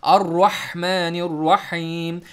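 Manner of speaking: अर्रहमानिर्रहीम slow with a throaty middle-throat ha; tajweed-style slow recitation; Arabic recitation. Shown as a demonstration. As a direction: recite अर्रहमानिर्रहीम stretched and guttural. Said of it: "हा middle throat से पढ़ें".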